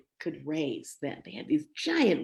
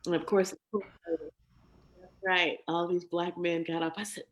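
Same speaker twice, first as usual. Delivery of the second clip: ordinary delivery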